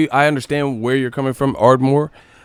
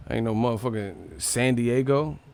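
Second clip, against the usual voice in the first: usual speaking voice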